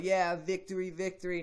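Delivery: high, put-on victory chant; gloating cheer. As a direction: crow it like a champ. Gloating after winning fight.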